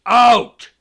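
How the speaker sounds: huge, high roar. A narrator's reading. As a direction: roar the single word. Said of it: "roared Uncle Vernon".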